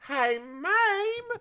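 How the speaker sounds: very high sung-out greeting; high diva call. Pretend. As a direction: trill the name up high.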